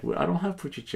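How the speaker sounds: lightly voiced clerk, high; high mock clerk. The clerk refusing the order, high and mocking. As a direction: tease the order back. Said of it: "They just like".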